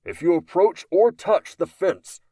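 loud, authoritarian announcement over a speaker; gruff loudspeaker. A narrator's reading. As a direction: bark orders through a megaphone. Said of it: "over a loudspeaker, gruff, authoritarian".

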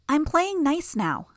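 put-on high, slow sing-song little girl; a creepy child promising good behaviour; eerie child.

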